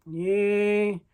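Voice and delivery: usual speaking voice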